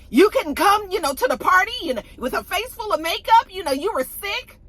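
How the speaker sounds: high, sing-song mocking voice; mocking taunt. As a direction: mimic her snidely.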